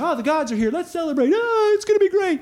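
rising to a very high laughing squeal; mock giddy celebration; giddy celebrating. Israelites celebrating the ark, giddy and laughing. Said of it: "the other side was like".

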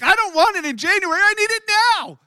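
loud, high, indignant shouting; mock furious complaint to the card company; retold angry outburst. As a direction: yell it like you're fed up with the bank. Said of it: "I'm like".

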